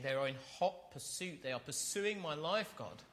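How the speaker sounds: voicing David's frantic plea for help; desperate plea. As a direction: cry out to God, hunted and begging. Preacher voicing David's desperate prayer.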